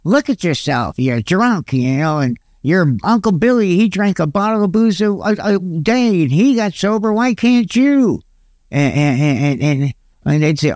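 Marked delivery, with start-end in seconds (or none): 0.0-8.2 mock yelling. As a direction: imitate a nagging relative, pitch climbing. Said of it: "were always yelling at me".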